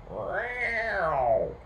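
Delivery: long, high sliding meow; cat yowling. A narrator's reading. Storyteller voicing the old cat, yowling. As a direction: yowl like a cat, rising then falling. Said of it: "He heard the old cat yowling".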